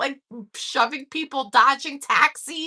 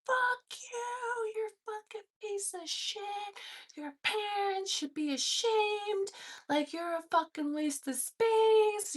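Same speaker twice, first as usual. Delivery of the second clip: high, soft mock scream; retold screaming tirade; 7 semitones higher, 7 dB quieter than usual. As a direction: imitate distant screaming without volume, drawing out the insults. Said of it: "this man, like, screaming".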